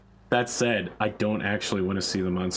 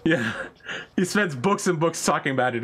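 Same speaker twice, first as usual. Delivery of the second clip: ordinary delivery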